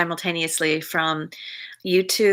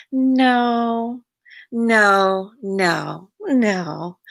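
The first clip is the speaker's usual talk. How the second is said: drawn-out coy little noes, starting higher and sliding down; coquettish; 4 semitones higher, much slower than usual. Shown as a demonstration. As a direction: soften each refusal like a playful flirt. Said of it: "you're being coquette to try to soften".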